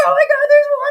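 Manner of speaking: high, loud mock-panic cry; panicked. Telling how they jumped into the flooding ship, panicked. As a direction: shriek it as if the water is rising. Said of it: "I jumped down and I panicked".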